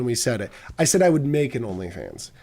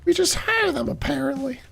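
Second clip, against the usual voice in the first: very high, chirpy cartoon cop; high squeaky officer voice. High character line.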